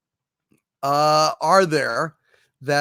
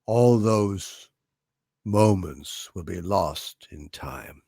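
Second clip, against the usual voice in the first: dying monologue; 9 semitones lower, 5 dB quieter, slower than usual. Acted. Performs the dying replicant monologue.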